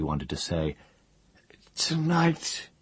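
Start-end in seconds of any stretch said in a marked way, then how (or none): 1.8-2.7 high, eerie half-mad voice; a broken man stating a dark truth; crazed old wizard. A narrator's reading. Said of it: "he said at last".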